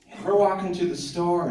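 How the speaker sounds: usual speaking voice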